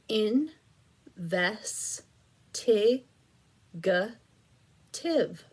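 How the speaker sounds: "Investigative" drawn out syllable by syllable, very slow; split into syllables. Shown as a demonstration. Teacher sounds out "Investigative" syllable by syllable, very slow. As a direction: pull "Investigative" apart into separate beats. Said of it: "Let's do two more".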